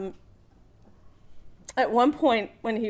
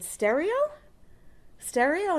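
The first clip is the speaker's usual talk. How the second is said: questioning guess; 6 semitones higher, slower than usual. Retold or imitated. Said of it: "I took a stab at it".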